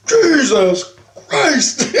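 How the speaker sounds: high, loud, drawn-out outcry; imitated outraged cry, very slow; shocked exclamation. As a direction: stretch it out in scandalized shock. Shocked exclamation on seeing magazine.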